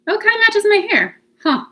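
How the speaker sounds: high, loud delighted oh; surprised. Real, not acted. Noticing the polish matches her hair, high loud surprise. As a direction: caught off guard by a happy discovery.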